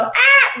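high, loud "ah" yell; imitated yell. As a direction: mimic the old dog's sudden cry. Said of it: "grita así, pues".

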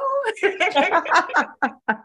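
a high amused laugh. Real, not acted. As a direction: laugh at the awkward memory. Laughing at the memory.

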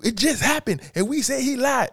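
very high mocking falsetto; hammed-up high-pitched caricature of a pundit; mocking Stephen A. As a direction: squeak it out in a ridiculing mimic voice. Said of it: "because he didn't say".

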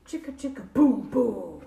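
loud, falling booms; crashing booms. A narrator's reading. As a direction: drop each boom like a heavy thud. Letters crash from the tree.

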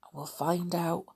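ordinary delivery, nothing marked

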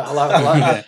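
chuckling through a dopey hello; laughing hello. Retold or imitated. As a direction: laugh while calling hello. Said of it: "my voice was in his truck".

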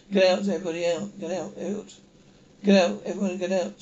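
loud urgent shouts to get out; shouting get out. Acted. Crew member shouting at passengers to get out, loud and urgent. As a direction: yell at everyone to get out now. Panic on the spaceship.

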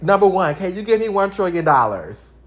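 loud, cheeky demands to the President; hammed-up loud questions, all attitude; mock-outraged kid demanding answers; sassy demanding letter. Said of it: "first of all, I said".

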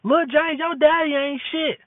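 high mock bad-mouthing of the father, no shouting; imitating a bitter mother. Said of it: "They hear".

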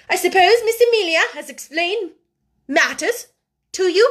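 loud, high, imperious question; haughty severity. A narrator's reading. Headmistress questions the newly orphaned pupil, severely.

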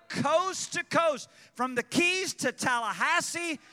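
high, loud fervent preaching; rally the whole state in a loud, high preacher's cry; preaching at full force. Acted.

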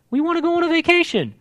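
put-on pleading kids' voice. Mercy and truth voiced as kids.

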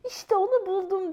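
high, thrilled exclamation; delighted little squirrel shout; excited cry. Acted. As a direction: cry out as if finding treasure. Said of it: "diye bağırdı".

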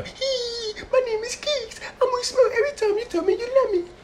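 squeaky high girly mock voice, fast; mocking Keeks. Mocking his smiling girlfriend Keeks in a squeaky voice. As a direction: tease her with a tiny high-pitched voice. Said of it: "She's over there smiling right now".

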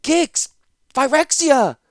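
high, loud despairing cry; cried names. Acted. Zancha crying out the enemy's names, high and loud. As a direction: fling the names out.

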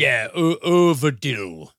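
put-on Urvadil in a slow Scandinavian accent. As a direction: Urvadil with a mock Scandinavian lilt. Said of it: "I love the attempt at a Swedish or, ... Scandinavian accent".

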